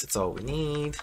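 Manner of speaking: usual speaking voice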